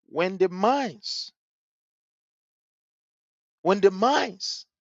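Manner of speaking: "minds" stretched out slow and heavy; slow emphasis. Real, not acted. Educator lecturing viewers about undeveloped minds, slow weighty stress. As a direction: lean on "when the minds" slowly, twice.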